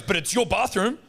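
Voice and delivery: imitating the cleaner insisting it's their bathroom; mock cleaner. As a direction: pitch it up and whinge. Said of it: "then he goes".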